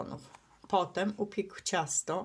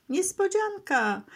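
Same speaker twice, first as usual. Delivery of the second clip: joyful shout; 11 semitones higher, 3 dB louder than usual. A narrator's reading. Friends jump out at the skunk, excited call. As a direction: sing it out high like a party cheer. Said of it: "Zawołali wszyscy chórem".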